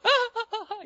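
high-pitched fake sobs; string out hammed-up wails, very high; mock bawling. Imitates another complainer wailing. (choro fingido, agudo e exagerado)

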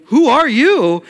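high, loud startled cry; loud startled who are you. Pretend. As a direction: jolt awake and call out. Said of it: "a startling".

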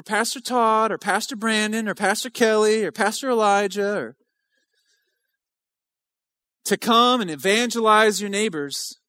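loud, driving preacher's cadence; preaching at full force. Acted. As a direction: hammer the questions home to the church.

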